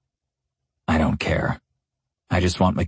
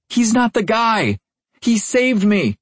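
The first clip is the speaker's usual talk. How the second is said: desperate cry; slower than usual. A narrator's reading. Michaela pleading, high and urgent.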